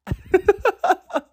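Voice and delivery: high-pitched burst of laughter; laughing. Real, not acted. Cracking up at own sheep joke, high laughter.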